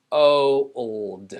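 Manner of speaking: put-on "o, old" with the vowel isolated; sounded out. Shown as a demonstration. Teacher models single-letter sound, sounded out. Sounding out hold once more.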